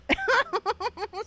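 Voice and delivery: a burst of high laughter; cracking up at herself. Real, not acted. Laughing at own rant.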